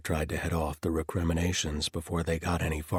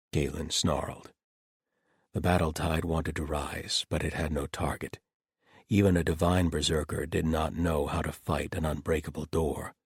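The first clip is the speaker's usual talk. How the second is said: plain reading voice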